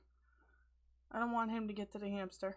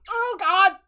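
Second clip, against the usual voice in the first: real startled cry, louder, then a high laugh; 16 dB louder than usual. Real, not acted. Startled when the angry cat squeaks, real loud cry. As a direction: jump at the sudden noise.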